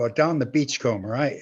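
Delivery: normal talking voice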